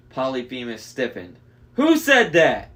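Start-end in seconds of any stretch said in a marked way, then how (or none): 1.7-2.7 loud, high giant demand; startled Cyclops. A narrator's reading. Cyclops demands who spoke, bellowing. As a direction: bellow the question suspiciously.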